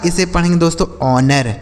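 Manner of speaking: ordinary delivery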